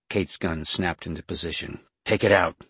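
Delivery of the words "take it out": loud, firm command; order. A narrator's reading. Woman with a gun ordering Jason, loud command. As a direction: order it forcefully. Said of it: "she ordered".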